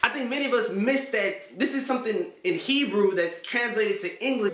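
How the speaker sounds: ordinary talk, nothing marked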